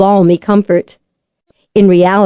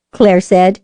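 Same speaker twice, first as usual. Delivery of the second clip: usual speaking voice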